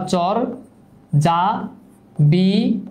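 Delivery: "চর, যা, বি", each drawn out and rising, with pauses between; split into syllables. Shown as a demonstration. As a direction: sound out "চর, যা, বি" one syllable at a time. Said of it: "ছোট ছোট অংশে ভাগ করি".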